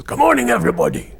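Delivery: high, loud Scottish greeting; Scottish accent. Acted. McDougall coming into the office, Scottish accent. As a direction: call it out in a put-on Scottish accent. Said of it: "my Scottish accent".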